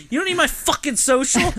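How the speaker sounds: high-pitched indignant yelling; irate protest. Retold or imitated. Drunk friend refusing a cop his social, high irate protest.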